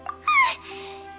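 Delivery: high, sharp frightened yelp; startled shriek. Acted. Startled by the water ball.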